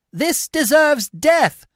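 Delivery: high, slow, loud verdict; condemning shout. A narrator's reading. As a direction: pronounce outrage at treason. Tribune condemns Coriolanus.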